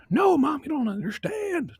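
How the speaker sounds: put-on frantic kid. Little boy grabbing his mom, screaming about the robots. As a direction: shriek it, clinging to mom.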